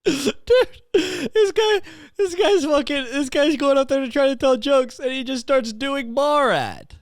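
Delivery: high, squealing real laughter in the voice; cracking up. Real, not acted. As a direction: barely get it out for laughing. Cracking up retelling the Borat story.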